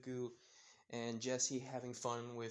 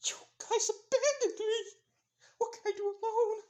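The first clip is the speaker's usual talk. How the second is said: panicked wail; 9 dB louder than usual. Acted. Mina abandoned by friends.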